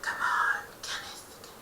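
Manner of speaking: soft otherworldly whisper; eerie creature whisper. Acted. The Enderman tells Kenneth his fate in a whisper. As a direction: whisper like a being from another world.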